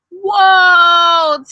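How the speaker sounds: loud, high whoa; surprised whoa. Acted. Tony amazed at the heartbeat count, loud whoa. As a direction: gasp it out in amazement. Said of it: "Tony looked surprised".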